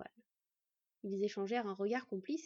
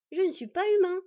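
high, much louder objection; loud protest; 8 semitones higher, 12 dB louder than usual. A narrator's reading. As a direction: protest hotly against an insult. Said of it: "protesta le plus jeune". (protestation vive, haute et forte)

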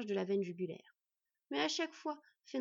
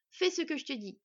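firm order, pitched up; roaring command; 5 semitones higher, 4 dB louder than usual. A narrator's reading. She-wolf silences the boy's protest, roaring mildly. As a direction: cut off his objection with force. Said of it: "rugit la louve".

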